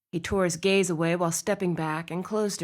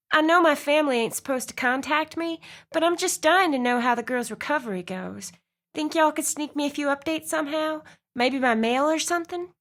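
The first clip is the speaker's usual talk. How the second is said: higher, pleading Southern woman's voice; southern accent; 8 semitones higher than usual. Acted. Reese begging the officer for family updates, higher Southern accent. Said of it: "the sound of a woman's southern accent".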